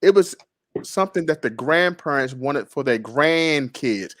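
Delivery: normal talking voice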